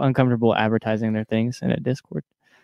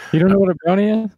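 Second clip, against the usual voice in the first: incredulous; 7 semitones higher, 4 dB louder, faster than usual. Real, not acted. Disbelief at friend's ignorance.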